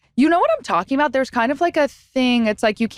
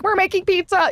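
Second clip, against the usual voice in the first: excited; 10 semitones higher than usual. Retold or imitated. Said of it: "And then I'm like".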